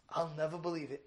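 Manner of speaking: normal talking voice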